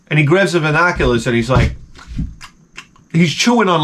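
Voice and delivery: usual speaking voice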